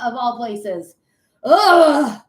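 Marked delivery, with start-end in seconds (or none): 1.4-2.2 loud disgusted ugh; loud annoyed ugh; groan of disgust. Real, not acted. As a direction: let out a fed-up groan. Frustration at hockey loss.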